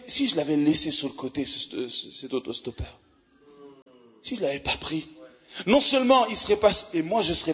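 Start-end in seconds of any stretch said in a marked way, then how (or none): 0.1-5.0 awed hush. Real, not acted. Hushed reflection on missed chance.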